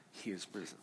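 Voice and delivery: low, soft, listless mumble of the line; mumbled and limp. Shown as a demonstration. Pastor showing kids the wrong way, limp and mumbled. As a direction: say it like you don't care at all. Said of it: "you can't say it like this".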